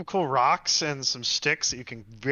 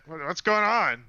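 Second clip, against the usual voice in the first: higher, urgent calling-out voice; alarmed caravan master; 4 semitones higher, 3 dB louder than usual. Acted. Caravan master coming out of his tent after combat, alarmed. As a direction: call out in concern to the camp. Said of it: "the caravan master, you know, comes out".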